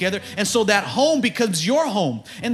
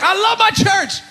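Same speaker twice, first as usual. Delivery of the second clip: joyful shout; 11 semitones higher, 5 dB louder than usual. Retold or imitated. Voicing David's joy about church.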